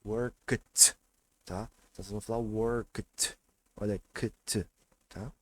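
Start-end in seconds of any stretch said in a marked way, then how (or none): 0.0-1.0 worked as one syllable ending in "kt"; final KT sound. Shown as a demonstration. Teacher modelling the past tense, worked ending in "kt". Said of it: "vai ter som de T". 2.4-4.7 worked said "workt", then the "kt" alone. Shown as a demonstration. Teacher telling students how to say it, worked as "workt". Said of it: "vai ter som de T".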